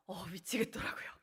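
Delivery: soft breathy whisper, overwhelmed. Real, not acted. Speaker recalling being overwhelmed, whispering. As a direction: let it drop to a whisper.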